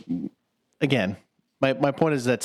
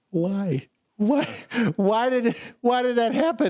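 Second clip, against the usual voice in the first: high pleading whys, mock tearful; high mock-sobbing child asking why; childlike wail; 8 semitones higher, slower than usual. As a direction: cry it out like a hurt kid. Crying kid watching film.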